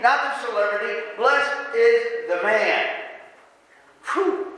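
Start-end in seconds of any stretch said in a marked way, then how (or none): 1.2-2.9 each word laid down slowly; slow, deliberate scripture emphasis; slow, weighty reading. Shown as a demonstration. Repeats the verse slowly for weight. 4.1-4.5 high, breathy exhale of mock fatigue; playful worn-out sigh; mock tired whew. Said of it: "we're already tired".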